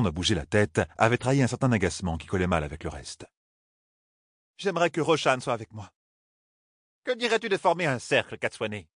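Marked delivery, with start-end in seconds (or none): 4.6-5.9 voix plus aiguë, soupirée, plus douce; sœur jaune soupirante. A narrator's reading. 7.0-8.8 voix bien plus aiguë, vive; propose-le nerveusement; sœur grise. A narrator's reading. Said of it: "demanda la sœur grise".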